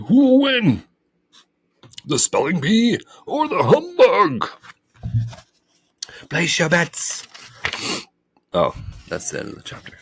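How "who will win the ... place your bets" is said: loud, very high hype; fight announcer. Acted. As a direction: hype the match like a ring announcer.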